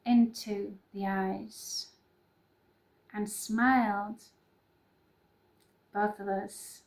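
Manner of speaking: slow, soft, measured recital; poem reading. Acted. Reading her personal poem to viewers, slow and soft. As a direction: linger on each phrase like a love poem.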